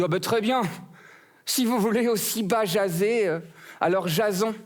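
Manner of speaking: rose's voice. Acted. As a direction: answer the pun playfully, up high.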